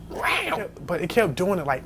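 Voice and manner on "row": high imitated cat yowl. Showing the cat's noise to his friend, a high yowl. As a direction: squeal it like a fighting cat. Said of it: "the cat was like".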